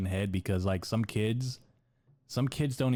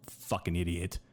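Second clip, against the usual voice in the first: ordinary delivery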